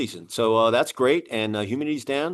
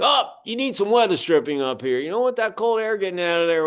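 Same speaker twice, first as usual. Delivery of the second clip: higher-pitched imitation of an old-timer inspector; good old boy; 6 semitones higher than usual. Inspector tells the homeowner about the attic ladder, good old boy imitation. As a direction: mimic a folksy veteran inspector, pitched up. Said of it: "Good old boy".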